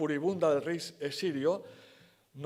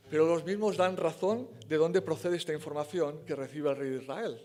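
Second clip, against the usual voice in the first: ordinary delivery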